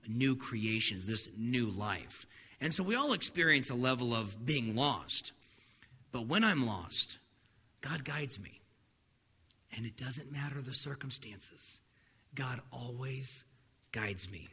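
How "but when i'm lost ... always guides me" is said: much softer, lower, slow repeat of the big idea; almost breathe the promise; hushed refrain. Shown as a demonstration. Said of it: "the big idea for today".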